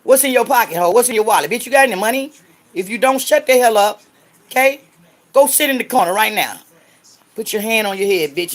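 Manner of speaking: high, loud scolding shout; angry yelling. Real, not acted. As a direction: tear into the heckler. Yelling at a commenter on stream.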